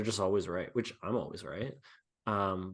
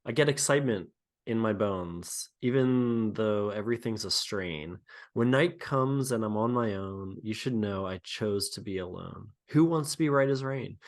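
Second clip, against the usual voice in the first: dramatic [name] song reading. Pretend. Asked to read lyrics like an [name] song, dramatic recital. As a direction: read it like a heartbroken pop ballad. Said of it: "read this in a way that you think it would be read aloud from an [name] song".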